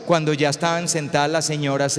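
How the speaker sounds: normal talking voice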